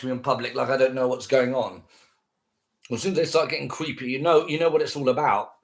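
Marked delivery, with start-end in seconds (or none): none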